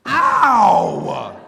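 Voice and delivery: loud sudden ow; pained yelp. Acted. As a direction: yelp in pain. Hit by brother.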